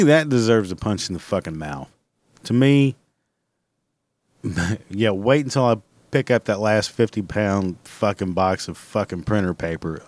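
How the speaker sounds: normal talking voice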